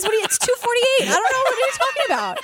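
rising, incredulous, half laughing; mock confusion at a riddle; baffled mock reply. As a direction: play it bewildered and exasperated. Mock reply to a cryptic quote.

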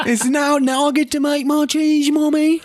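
put-on British kid accent. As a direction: chirp it as a posh British little boy. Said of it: "I don't know how this little kid's British".

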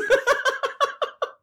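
high, loud burst of laughter. Real, not acted. Admitting they walked eight hours, loud high laugh. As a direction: crack up at the absurd length.

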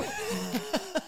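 high disbelieving laugh. Real, not acted. Telling the bar story, a high disbelieving laugh.

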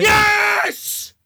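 very high, loud shout of joy; triumphant yell. Real, not acted. Gamer celebrating his winning goal, roaring yes. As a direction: scream it as the winner goes in. Scores winner in comeback.

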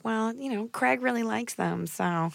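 softly mocking a wife who won't take a stand; passive wife voice. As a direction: shrug it off as the wife. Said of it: "and she's like".

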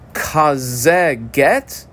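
"Kazeh get" drawn out very slowly, kazeh leaned on; slow teacher's emphasis on "Kazeh"; stress on kazeh. Shown as a demonstration. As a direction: underline kazeh with your voice, slow and deliberate. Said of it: "I double underline the word kazeh".

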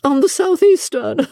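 high voice bubbling with laughter; high, giggly and overcome; uncontrollable mirth. A narrator's reading. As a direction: struggle to get the words out through mirth. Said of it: "She could not control her mirth".

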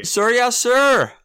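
high, loud cadet shout; stiff barking toy soldier. Acted. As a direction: bark it like a recruit. Recruit shout in bit.

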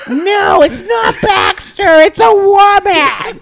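high slow yell with laughter; laughing kid shout, way up high. Retold or imitated. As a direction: insist loudly like a frightened child. Child insisting to mom.

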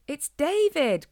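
high, loud, drawn-out call; excited cry. Acted. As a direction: shout with delight at a friend. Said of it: "cried Harris".

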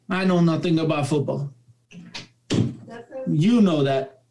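lightly attempted Spanish accent; imitated Spanish accent. Dad confessing to his son's coach, mild imitated Spanish accent. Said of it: "in my best Spanish accent".

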